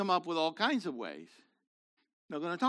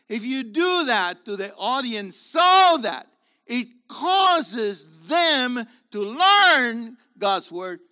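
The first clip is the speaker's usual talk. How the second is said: louder, slow, near breaking; impassioned preaching; 2 semitones higher, 7 dB louder, slower than usual. Acted. Preacher urging teachers to make people learn, impassioned. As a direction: press it home with feeling.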